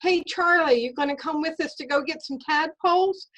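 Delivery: calls out high and loud across to a friend; kid calling out. Retold or imitated. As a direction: shout the invite to Charlie. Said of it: "I hear one of the kids say".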